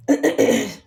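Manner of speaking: loud rough throat clear. Real, not acted. Vlogger with sore throat clearing it loudly. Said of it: "थोड़ा मेरा गला ख़राब है".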